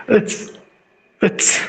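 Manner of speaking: short whispered ts sound; Russian ts. Shown as a demonstration. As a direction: say it's, it's with a crisp ts. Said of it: "sound out with me".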